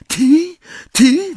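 very high, disbelieving question; stunned half-whisper. A narrator's reading. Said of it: "wyszeptała".

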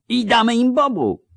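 put-on very high, thin young lieutenant's cry; thin shout. A narrator's reading. Beardless young lieutenant cheering on the attack, thin shout. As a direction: pipe up in a high, thin yell. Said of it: "krzyknął cienko jeden z poruczników".

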